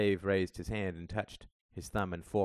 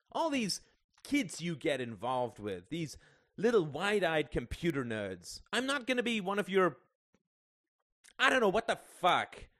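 high, loud, exasperated rant; angry tirade; 11 semitones higher, 5 dB louder than usual. A narrator's reading. Son accusing his father in a quarrel, exasperated and angry. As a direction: rant until the words give out.

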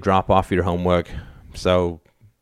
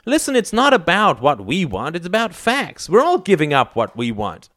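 high, insistent lecturing; exasperated mother's reproach; heated scolding; 10 semitones higher than usual. A narrator's reading. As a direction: tell them off, pitch raised. Mother scolds son in family quarrel.

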